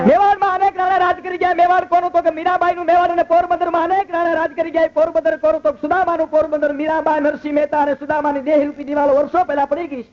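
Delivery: high-pitched, ringing storyteller's declamation; lifted oratory. Acted. Folk performer proclaiming saints' glory to the audience, lifted oratory. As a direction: proclaim each line up high to the crowd.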